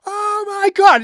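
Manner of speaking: high shrieking yell; stunned shout of disbelief. Retold or imitated. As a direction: blurt it out in shock, way up high. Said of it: "and I yelled".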